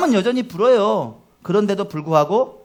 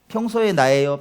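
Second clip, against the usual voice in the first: ordinary delivery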